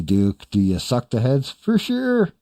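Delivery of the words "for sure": high squeaky for sure; mock for sure. Answering a chat question, high mocking squeak. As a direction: pipe it way up like a parody. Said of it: "dropping the catchphrase".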